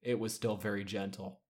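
ordinary delivery

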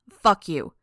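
loud, hostile rebuff; angry retort. A narrator's reading. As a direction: throw it at them, hard and loud. Angry retort in acted quarrel.

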